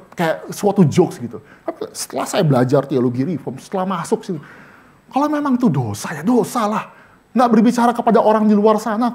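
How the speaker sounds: normal talking voice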